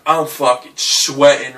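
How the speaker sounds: usual speaking voice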